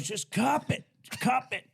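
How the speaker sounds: high, squeezed coaching; imitated smoke-holding. Hippie showing a teen how to smoke, imitated strained voice. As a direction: push it out on held breath.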